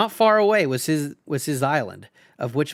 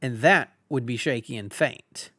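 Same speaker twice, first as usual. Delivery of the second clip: usual speaking voice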